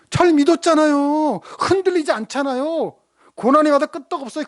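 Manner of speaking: ordinary talk, nothing marked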